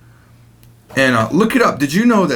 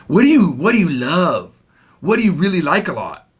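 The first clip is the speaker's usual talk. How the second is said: stilted textbook phrasing. Shown as a demonstration. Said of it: "We normally say".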